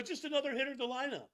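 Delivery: high, fast mocking echo; mock dismissive. Host parroting pitcher's line about Shohei, mock dismissive.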